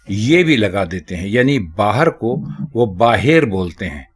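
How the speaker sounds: usual speaking voice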